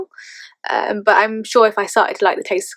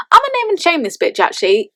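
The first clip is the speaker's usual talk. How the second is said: cheeky threat; 8 semitones higher than usual. Retold or imitated. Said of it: "I said to the deputy head teacher".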